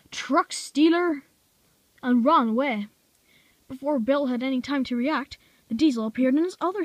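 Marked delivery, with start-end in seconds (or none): 0.1-1.2 yell. A narrator's reading. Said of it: "the diesel yelled".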